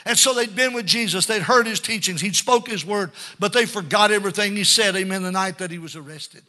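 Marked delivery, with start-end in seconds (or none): none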